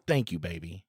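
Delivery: low, soft sweet thanks; mock sweet thanks. Shown as a demonstration. Husband shows wife how to thank him: low, soft, sweet. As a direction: coo it softly like a grateful wife. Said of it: "look at me and go".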